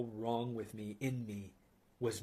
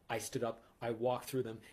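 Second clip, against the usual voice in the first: ordinary delivery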